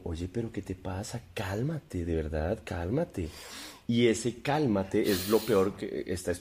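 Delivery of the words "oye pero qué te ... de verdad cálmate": soft, patronizing calm; mock calm, softer; mock soothing. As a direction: talk her down sweetly, provoking. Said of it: "entonces digo como".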